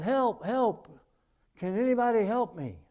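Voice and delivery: loud, high desperate cry for help; yelling for help. Acted. Man dangling from a sapling shouts for help, loud and high. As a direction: shout up the cliff for rescue. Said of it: "And he yelled out".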